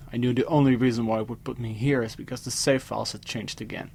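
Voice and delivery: ordinary delivery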